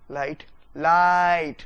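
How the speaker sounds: Light drawn out slow and loud, falling; stretched stress. Shown as a demonstration. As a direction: hold light long and punch it. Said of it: "बढ़ा देना है". (Light को धीरे, ज़ोर से खींचें)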